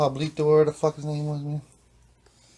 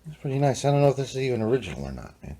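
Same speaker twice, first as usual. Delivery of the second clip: ordinary talk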